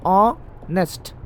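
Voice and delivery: Honest drawn out slowly with a silent h; slow demo. Shown as a demonstration. Teacher slowly models honest for the class. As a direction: stretch honest slowly, no h sound. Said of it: "देखो, एन".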